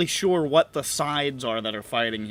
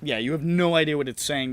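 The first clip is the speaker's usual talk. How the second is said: ordinary talk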